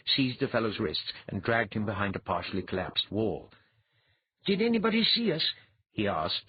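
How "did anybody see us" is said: quick, high, slightly breathless question. A narrator's reading. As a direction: ask fast, a touch out of breath. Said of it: "just a bit breathlessly".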